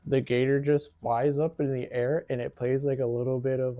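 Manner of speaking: usual speaking voice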